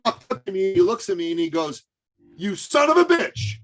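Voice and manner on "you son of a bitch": high-pitched, disbelieving accusation; mock outrage. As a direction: imitate a man amazed he gave in. Acted prospect's mock-outraged admission.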